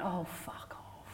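breathy whispered brush-off; whispered fuck off. Retold or imitated. Telling a friend to get lost, whispered. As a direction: hiss it under your breath.

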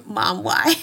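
ordinary talk, nothing marked

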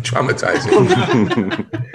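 slow, drawn out through laughter; laughing. Real, not acted. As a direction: laugh the word out slowly.

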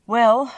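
a loud "Well," then a long tired sigh; weary sigh. Acted. False wizard gives in to the Scarecrow, sighing. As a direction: let the air go out of him. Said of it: "he said with a sigh".

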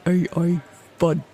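put-on a light Russian accent on fud. Joking about AI fodder, a light Russian accent. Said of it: "in the Russian accent".

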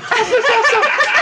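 high-pitched helpless laughing fit. Real, not acted. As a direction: laugh until it hurts.

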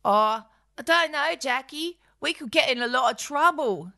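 high, worried sidekick voice; nervous companion. Acted. Bird warns Jackie against the theft, high and anxious.

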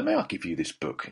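soft, low, deferential request; polite approach. Retold or imitated. Host offering his book to a star, politely tentative. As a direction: ask softly, as if not to intrude. Timid first approach to Colin Firth.

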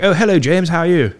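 high-pitched middle-class greeting; middle-class dad. Retold or imitated. Mate's dad greeting James, high middle-class voice. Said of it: "his dad does speak like that".

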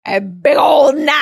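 stretched-out mock holler; hammed-up emphasis. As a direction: blow up how huge it is. Exaggerates the huge knife.

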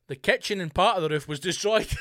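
reading that cracks up at the end; breaking into laughter. Real, not acted. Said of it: "I'm not laughing because the man's".

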